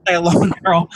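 repeating the line through a laugh; laughing echo. Real, not acted. Echoing her friend's advice, laughing.

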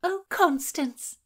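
high, slow, drawn-out cry of a friend's name; excited greeting. A narrator's reading. Girl greeting her friend, delighted and high.

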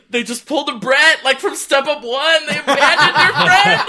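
high, loud, laughing indignation; mock outrage. Podcaster relives her shock at a plot twist, mock outraged.

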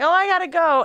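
high loud hurried exclaim; urgent. Retold or imitated. Needing to pee mid-threesome, high urgent exclaim. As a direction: blurt it like you have to run. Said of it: "I'm like".

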